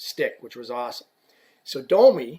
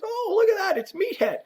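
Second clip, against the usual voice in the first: high, lilting fake discovery; mock-surprised announcement. Businessman announcing the player's arrival, high mock surprise. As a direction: pitch it way up in teasing surprise. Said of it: "he looks, he goes".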